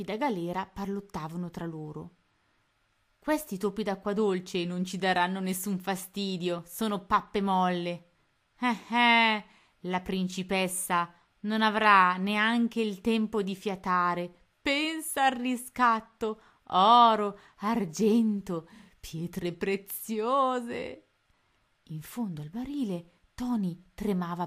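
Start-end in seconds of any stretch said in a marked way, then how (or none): 3.2-8.1 scornful pirate. Acted. Two pirates talk scornfully. 8.6-21.1 greedy pirate. Acted. Greedy pirate savours ransom.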